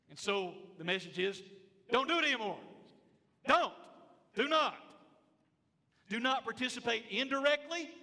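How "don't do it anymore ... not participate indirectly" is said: higher and louder, stern warning; forceful preaching. Acted. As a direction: drive the warning home from the pulpit.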